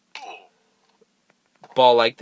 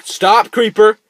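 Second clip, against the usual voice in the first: high, loud command; alarmed shout; 11 semitones higher, 9 dB louder, slower than usual. Real, not acted. Player shouting at a creeper, high and loud. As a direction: yell at the monster.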